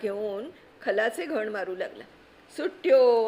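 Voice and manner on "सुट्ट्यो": put-on a child's call, much louder and higher; little boy shouting from the cellar; shouted call. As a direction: yell it from below. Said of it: "पुन्हा ओरडला".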